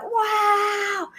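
put-on high, delighted kid's wow; amazed children. Children in the stands seeing the gorilla, amazed. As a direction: gasp in wonder like a child.

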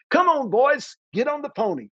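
high, coaxing call; luring the boys out sweetly; cheery orphanage woman. Retold or imitated. Orphanage woman coaxing the boys out of the car, high and sweet. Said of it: "opens the car door and says".

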